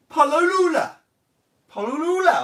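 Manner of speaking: "Palulula, Polulula" said high and loud, mock grand; hammed-up Latin. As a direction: ham up the odd old word. Mangled Latin word read aloud.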